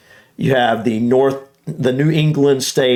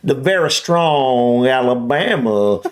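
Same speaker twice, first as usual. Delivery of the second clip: strong Alabama accent; slower than usual. Shown as a demonstration. Showing a listener the strongest Southern accent, slow Alabama drawl. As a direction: stretch every vowel in a thick Alabama drawl. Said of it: "goes something like this, of talking very slow".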